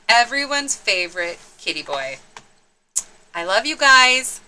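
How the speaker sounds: plain reading voice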